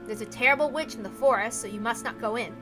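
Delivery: plain reading voice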